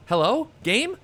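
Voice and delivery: high rising call to the frozen game. Real, not acted.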